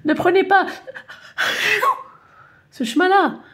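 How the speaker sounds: put-on high, loud stuffy-nosed old man; a cold-ridden man calling out; sneezy man's voice. Sneezing man warns the stubborn lady, high loud voice.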